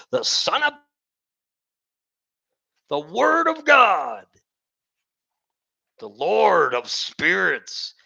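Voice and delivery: high, loud preaching that builds to a shout; fire and brimstone. Real, not acted. Preacher warns unbelievers in rising loud fire-and-brimstone shouts. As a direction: warn them hard, voice climbing.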